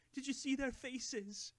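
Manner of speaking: high, slow, delighted. A narrator's reading. Old hobbit crowing to Gandalf about the party prank, gleeful. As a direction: savour the prank with glee. Bilbo gleeful after his trick.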